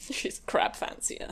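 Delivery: unvoiced whispered aside; whispering. Real, not acted. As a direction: breathe it out in a whisper.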